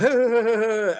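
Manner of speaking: put-on jeering laugh, imitating the bullies; imitated mocking laughter. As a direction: mimic a group laughing at someone. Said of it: "they'd be like".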